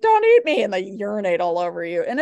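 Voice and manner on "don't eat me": high, loud panicked plea; snake's plea. Retold or imitated. Teacher voicing a frightened snake, high and loud. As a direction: squeal it like a scared snake. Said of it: "It's like".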